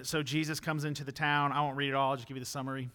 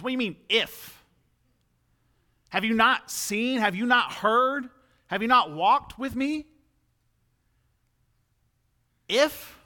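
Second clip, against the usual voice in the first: loud, high-pitched indignant questioning; mad; 7 semitones higher, 8 dB louder than usual. Acted. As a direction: challenge them, hammering each question in disbelief. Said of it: "kind of makes Jesus mad".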